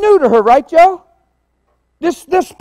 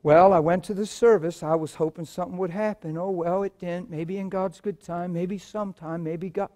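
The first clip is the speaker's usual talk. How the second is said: softer, lower resigned mumble; mock resigned believer; 8 semitones lower, 10 dB quieter than usual. Preacher mimicking a disappointed churchgoer, soft and resigned. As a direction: sigh your way through the excuses. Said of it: "I know what the tendency is".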